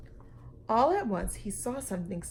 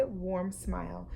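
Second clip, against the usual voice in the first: usual speaking voice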